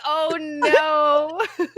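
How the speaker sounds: a high, laughing oh no; mock dismay. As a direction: squeak out a horrified oh no. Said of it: "I was like".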